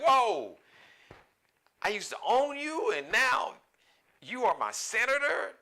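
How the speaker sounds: high-pitched, incredulous imitation; mock astonishment. Acting out astonished former slave owner.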